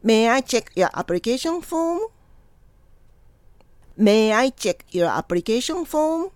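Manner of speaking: slow, carefully articulated question; repeat-after-me model. Shown as a demonstration. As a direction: model the question unhurriedly for learners to copy. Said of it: "Repeat after me". (ゆっくり丁寧に発音するお手本)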